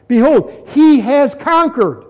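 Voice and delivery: "he has conquered" pitched high and drawn out; high, slow emphasis on "conquered"; emphatic proclamation. Shown as a demonstration. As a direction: proclaim "Behold", then hit "he has conquered" slowly. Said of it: "there's emphasis with that".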